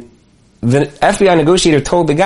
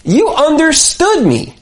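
much higher, slow and heartfelt; being understood; 10 semitones higher, slower than usual. Retold or imitated. Warlord's recognition, slow heartfelt high voice. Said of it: "It was a recognition of".

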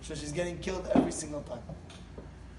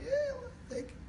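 high squeaky reply; 6 dB quieter than usual. Retold or imitated. Imagined exchange over handing wife money.

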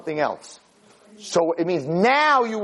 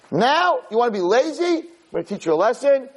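mild retold scolding, pitched up; 7 semitones higher than usual. Pharaoh scolds the workers he calls lazy, scolding. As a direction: act out a ruler laying down the law.

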